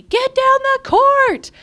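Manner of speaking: loud, high, drawn-out yell; yelling coach dad. Retold or imitated. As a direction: holler it like a dad coaching from the sideline. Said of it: "friend's dad yelling at me".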